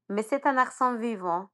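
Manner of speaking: ordinary talk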